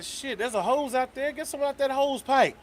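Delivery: a hint of a breezy host; high casual reply. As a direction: toss off a careless answer, high. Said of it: "I was like".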